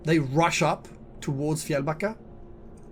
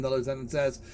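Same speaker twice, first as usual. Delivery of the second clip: usual speaking voice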